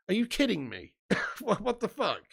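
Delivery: can't believe what he heard; disbelief. Real, not acted. Reacting to Saka over Beckham.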